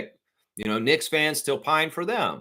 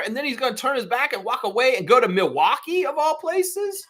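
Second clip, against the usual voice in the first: worked-up rant; 9 semitones higher than usual. Real, not acted. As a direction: pitch jumps way up as the outrage builds. Ranting about a star leaving.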